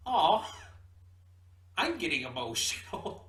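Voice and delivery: a high, loud aww, then gushing. Retold or imitated. The girl arriving at the castle, overwhelmed, gushing aww. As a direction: coo it out like you're overwhelmed and touched.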